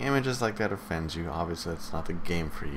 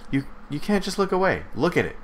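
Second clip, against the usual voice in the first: insistent; 10 semitones higher, faster than usual. Real, not acted. Urging viewers to look.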